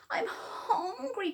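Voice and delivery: high, slow pleading cry; cried. Acted. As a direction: whine it like a starving squirrel. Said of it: "cried Cyril".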